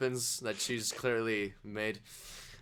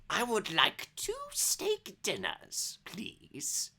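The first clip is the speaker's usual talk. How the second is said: high, slow granny falling to a soft finish; old granny voice; 8 semitones higher, 4 dB quieter, slower than usual. Acted. Granny orders steak at the counter, sweet old-lady voice. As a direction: order slowly, start high, end in a near whisper.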